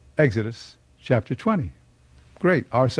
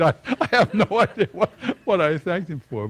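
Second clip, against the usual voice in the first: laughing; 8 semitones higher than usual. Real, not acted. Laughing at his own prayer.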